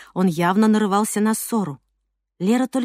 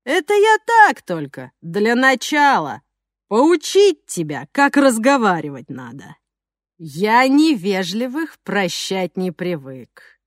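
slow theatrical villain menace; slower than usual. Acted. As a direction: savor each threat slowly. Thug Zhokh threatening.

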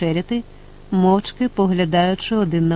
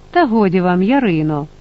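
usual speaking voice

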